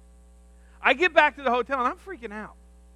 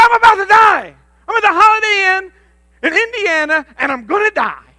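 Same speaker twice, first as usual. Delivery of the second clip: much higher and louder frantic yell; mock panicked shout; 10 semitones higher, 12 dB louder than usual. Son shouting to his mother that he's dying, much higher and louder. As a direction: shriek it into the phone. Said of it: "I said".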